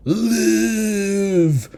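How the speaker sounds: high, hammed-up cry of the word; Victor's overblown last wish to the monster; mock dying plea. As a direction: overplay a dying father's last order. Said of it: "Victor's like".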